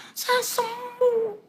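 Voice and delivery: very high, drawn-out squeal; ecstatic announcement. Retold or imitated. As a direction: stretch the joy out in falsetto. Acted phone call announcing healing. (pekik girang memanjang)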